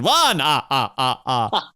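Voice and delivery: hammed-up vampire counting with a staccato laugh; theatrical cartoon vampire count; Count from Sesame Street. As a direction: count grandly, then a mock villain cackle. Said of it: "like the Count from Sesame Street".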